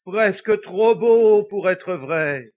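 high, loud, slow and overcome; deeply moved. Acted. Pope marvelling at the devil's story, deeply moved. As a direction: draw it out, swelling with emotion. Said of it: "murmura-t-il d'une voix émue".

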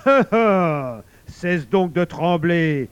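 loud, slow beast's laughter into command; growling big laugh. A narrator's reading. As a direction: laugh heartily, then boom it out. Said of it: "gronda le fauve en partant d'un grand rire". (grondement de fauve dans un grand rire)

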